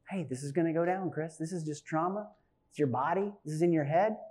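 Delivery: calming self-talk. Retold or imitated. Calming self-talk while choking.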